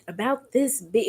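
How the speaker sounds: usual speaking voice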